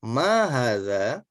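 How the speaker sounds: "Ma hadza?" with a rising question tune, said slowly; teacher demonstrating question intonation on "Ma hadza?". Shown as a demonstration. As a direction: lift the end of "Ma hadza?" as a question. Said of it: "maka nadanya pun nada bertanya".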